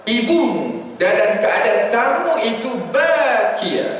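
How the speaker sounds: normal talking voice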